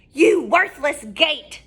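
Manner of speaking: slow, loud witch shriek; witch scream. Acted. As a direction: scream at the gate. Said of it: "she screamed".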